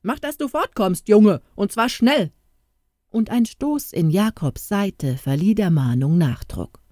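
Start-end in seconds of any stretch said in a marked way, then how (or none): 0.0-2.3 gruff order. A narrator's reading. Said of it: "eine barsche Stimme".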